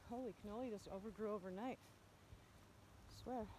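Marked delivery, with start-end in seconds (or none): none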